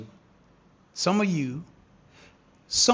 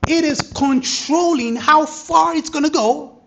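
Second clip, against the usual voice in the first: louder, higher, hyped explaining; excited; 5 semitones higher, 8 dB louder than usual. Real, not acted. Trader explaining the controller to viewers, excited. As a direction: keep the hype while you explain.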